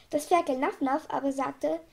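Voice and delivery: ordinary delivery, nothing marked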